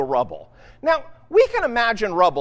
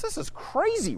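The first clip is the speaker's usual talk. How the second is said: exasperated; 10 semitones higher, 7 dB quieter than usual. Retold or imitated. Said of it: "Nehemiah looks at it and says".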